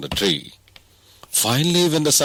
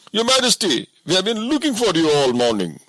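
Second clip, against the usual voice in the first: guards greeting their found king; relieved bodyguard; 12 semitones higher than usual. A narrator's reading.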